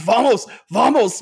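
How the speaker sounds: urgent, hammed-up shout to the kids; imitated yelling. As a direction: imitate a frantic yell without full volume. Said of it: "yelling at the screen".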